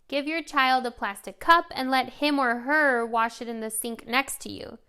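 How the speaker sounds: ordinary delivery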